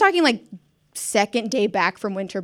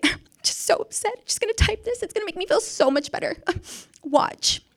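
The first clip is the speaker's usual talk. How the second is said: high, tearful-sounding comic whimper; hammed-up upset squeak while typing a letter; mock upset; 10 semitones higher than usual. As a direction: whimper it in exaggerated self-pity. Acting herself upset, typing resignation letter.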